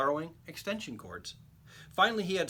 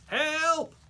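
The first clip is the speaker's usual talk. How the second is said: put-on high, loud cry for help, old lady in a fire; old lady calling out from the burning window; trapped old lady cries out loudly; 12 semitones higher, 7 dB louder than usual. A narrator's reading. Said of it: "she cried".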